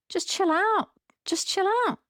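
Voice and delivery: high, lilting "just chill out"; high sing-song chill. Retold or imitated. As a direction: float it up high and breezy. Voicing calm advice to drivers.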